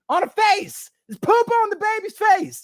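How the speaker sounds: high, loud outraged cry; shrill-free high exclamation of disgust; outraged exclaim. Retold or imitated. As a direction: disbelief at poop on the baby's face. Disgusted about poop on baby.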